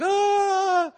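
sudden high, loud yell of shock; startled yell. Retold or imitated. Man being prayed over lets out a high startled yell. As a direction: let out a piercing cry like a jolt hit you.

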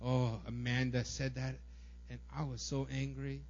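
put-on stew quietly over an old grudge; understated simmering resentment; stewing anger. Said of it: "marinate in that anger".

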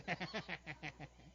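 mocking staccato laugh; imitated child's laugh. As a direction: laugh at dad like a cheeky kid. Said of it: "he laughs at me".

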